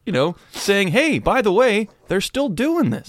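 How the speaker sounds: high, exasperated self-defence; indignant protest. Acted. Comedian blaming the church to an offended audience, indignant. Continues retort to offended crowd.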